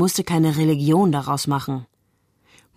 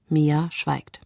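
plain reading voice

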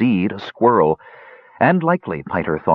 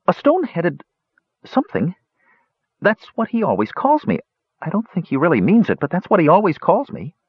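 unsure squirrel; 9 semitones higher than usual. A narrator's reading. Squirrel recalls a name.

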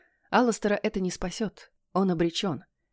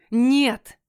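loud, high roar of refusal; 5 semitones higher, 5 dB louder than usual. A narrator's reading. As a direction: roar it out in despair. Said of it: "взревел он". (отчаянный рёв)

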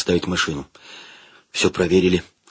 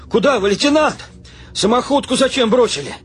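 high-pitched shouted questions; indignant calling; 8 semitones higher than usual. A narrator's reading. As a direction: call after the retreating officer. Soldier runs after retreating commander, calling.

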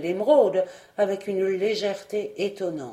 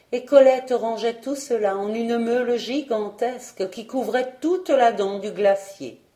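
ordinary delivery, nothing marked

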